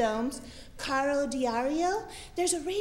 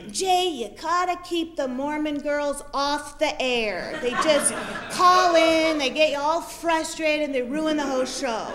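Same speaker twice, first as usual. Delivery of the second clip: caller's complaint; 3 semitones higher, 7 dB louder than usual. Retold or imitated. Regular caller voiced in story.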